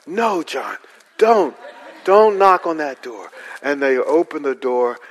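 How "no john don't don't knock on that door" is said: parents warning. Retold or imitated. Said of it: "I could hear my parents going".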